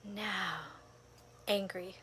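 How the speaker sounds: breathy whisper of the word angry. Shown as a demonstration. Naming her anger in meditation, whispered. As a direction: let angry slip out as a whisper. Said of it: "every moment I would take a breath".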